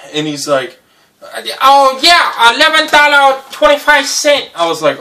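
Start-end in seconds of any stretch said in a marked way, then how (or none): 1.6-4.5 high, loud broken English whine; delivery man stuck in the snow, high and loud in a put-on accent; put-on accent of the driver. Driver names the price.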